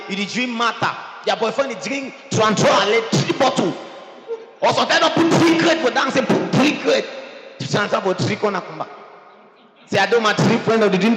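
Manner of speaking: loud, high-pitched rebuke; full-force preaching. Real, not acted. Preacher challenges drinkers who claim love, full-force preaching. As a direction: shout it down at the congregation, insistent.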